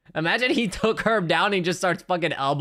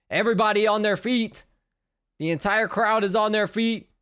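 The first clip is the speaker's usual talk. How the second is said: high, excited fight commentary; live play-by-play; 8 semitones higher than usual. Real, not acted. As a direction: call the action live, pitched up high.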